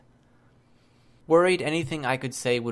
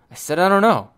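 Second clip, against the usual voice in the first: louder, higher, exasperated burst; irritated retort; 7 semitones higher, 9 dB louder than usual. A narrator's reading. Teammate pressed about the thanks, irritated retort. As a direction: cut off the questioning with raised voice. Irritated repeat of her answer.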